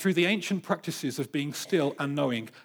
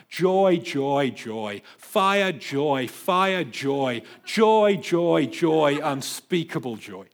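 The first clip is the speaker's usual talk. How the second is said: joy and fire cried out louder and louder, then eased into unspeakable joy; ecstatic joy; 5 dB louder, much slower than usual. Retold or imitated. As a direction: build to rapturous shouting, then melt. Climax of Pascal's ecstatic diary.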